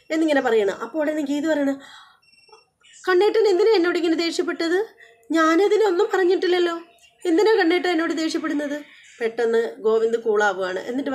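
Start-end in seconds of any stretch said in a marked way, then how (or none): 3.1-8.8 higher, hurt and complaining; hurt pleading. Retold or imitated. Wife protesting her husband's anger, hurt and high. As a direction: ask why he snapped, wounded.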